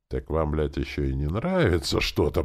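plain reading voice

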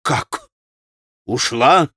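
startled, disbelieving exclamation; вскричал. A narrator's reading. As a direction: cry out in short shocked bursts. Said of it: "вскричал Митя". (изумлённый возглас)

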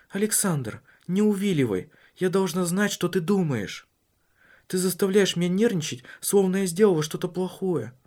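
a worried wife pressing her husband; [name]'s voice. Acted.

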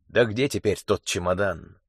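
plain reading voice

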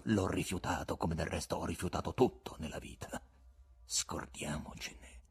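low, sombre dismissal; gloomy disdain. A narrator's reading. Guest renouncing his name to Ivan, darkly dismissive. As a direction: let it sink, soft and scornful, by the end. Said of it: "con un cupo disprezzo".

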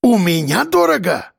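high, offended question; stung, incredulous retort; flaring up. A narrator's reading. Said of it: "вспыхнул Акбар".

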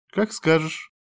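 mildly put-upon, letting it go; sighing. A narrator's reading. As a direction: give in with a light sigh. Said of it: "вздохнула та".

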